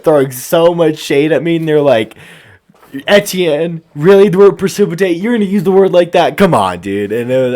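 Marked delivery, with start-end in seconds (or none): 3.1-7.1 loud, higher, mock-outraged ribbing; mock indignant teasing. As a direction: tease him like a scandalised classmate. Classmates mocking his word choice.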